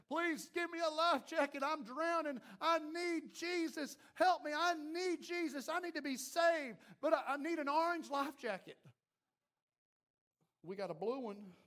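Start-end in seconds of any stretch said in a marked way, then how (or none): 0.1-8.8 higher pleading voice of a drowning man, slowing on I need Jesus. Acted. Preacher voicing a drowning man who begs for a life jacket, higher pleading voice. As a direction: beg for rescue from the water, voice pitched up. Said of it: "and they're saying".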